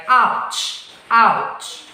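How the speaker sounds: "ouch" with an ow glide; teaching "ouch" twice; diphthong demo. Shown as a demonstration. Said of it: "words with the ow sound".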